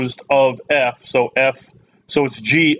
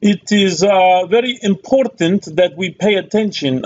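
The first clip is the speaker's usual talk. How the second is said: higher-pitched mock accent of no clear country; put-on foreign accent; 6 semitones higher, 3 dB louder than usual. Maths teacher welcoming viewers to a precalculus video, put-on accent. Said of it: "I don't know why I'm doing that accent".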